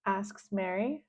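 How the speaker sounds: ordinary delivery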